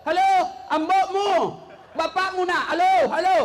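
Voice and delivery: loud shouted halo, rising; shouting into phone. Retold or imitated. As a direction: yell down the phone like a confused old man.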